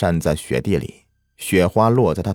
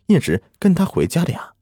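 ordinary delivery

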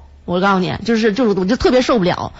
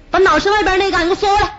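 raised, pitched-up bus driver yelling at a passenger; mock driver shout; 8 semitones higher, faster than usual. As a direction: call it out like a cranky driver. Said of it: "听前面司机喊话了". (模仿司机高声喊话)

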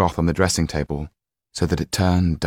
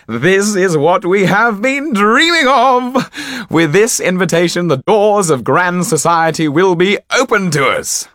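high, loud, exultant cry; jubilant magician; 13 semitones higher, 8 dB louder than usual. A narrator's reading. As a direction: crow with joy while dancing round the room. Topolan dances round the room.

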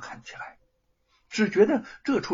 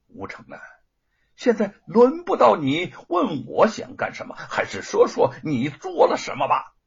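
冷笑着说; 7 semitones higher, 4 dB louder than usual. Acted. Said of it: "冷笑一阵".

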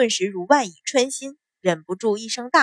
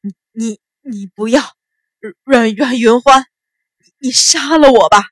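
fearful stammer building to a loud cry; trembling voice; 8 dB louder than usual. Acted. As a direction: stammer in terror, then cry out pleading. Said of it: "颤声道".

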